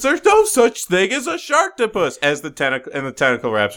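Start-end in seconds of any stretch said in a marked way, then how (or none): 0.0-2.2 high, overacted denial; hammy denial. Podcaster quoting the doomed skeptic character, high and hammy. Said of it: "He says".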